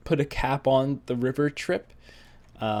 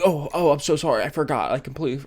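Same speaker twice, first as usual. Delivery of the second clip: higher, louder flustered apology; mock panic; 4 semitones higher, 4 dB louder, faster than usual. Friend who forgot apologizes, in mock panic. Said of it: "they panic a little bit".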